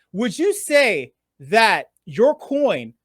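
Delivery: slow and drawn out, buying time; stalling slow. Shown as a demonstration. Host stalling so the coin price can change, slowly. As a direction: stretch every word out slowly to stall. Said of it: "for a reason".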